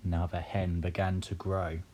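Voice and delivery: ordinary delivery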